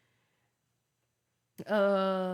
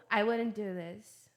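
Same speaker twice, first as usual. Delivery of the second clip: "wouldn't" American-style, T swallowed, slow; slow American demo of "wouldn't"; American pronunciation; slower than usual. Shown as a demonstration. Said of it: "Tô dando as pronúncias British e American".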